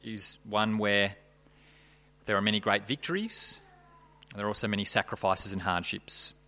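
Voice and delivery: soft, quiet prayer voice; hushed reverent opening prayer. Real, not acted. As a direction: pray humbly and gently.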